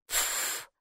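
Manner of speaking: unvoiced dismissive snort. A narrator's reading. Girl snorts at the robe, dismissive puff. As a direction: puff air out in scorn. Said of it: "фыркнула Майки".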